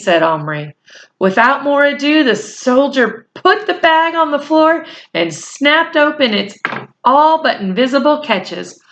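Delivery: plain reading voice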